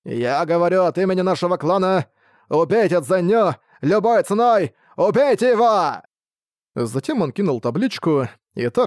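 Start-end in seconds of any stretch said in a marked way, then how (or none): 0.0-6.0 high, forceful shouted order; rallying shout that builds to a peak; loud cry. A narrator's reading. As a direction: call the whole clan to attack, pitch climbing. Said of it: "Он громко закричал".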